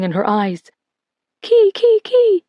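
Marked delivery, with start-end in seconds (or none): 1.4-2.4 very high, small tearful whimper; a scared child whimpering to herself; frightened whimper. A narrator's reading. Little sister alone in fear, high whimper. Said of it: "she whimpered under her breath".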